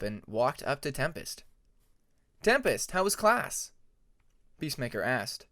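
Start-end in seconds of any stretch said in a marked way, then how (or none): 2.4-3.7 higher, bright hello to a friend; grinning hello; eager greeting. Acted.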